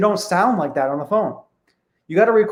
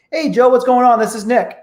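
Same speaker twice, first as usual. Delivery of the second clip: bright, higher friendly greeting by name; upbeat and personal; 6 semitones higher, 3 dB louder than usual. Shown as a demonstration. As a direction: greet him like an old friend. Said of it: "It's upbeat, it's personal".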